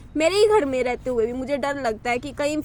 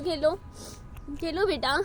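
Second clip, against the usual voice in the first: ordinary talk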